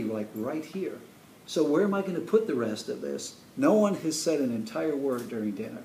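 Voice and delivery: ordinary talk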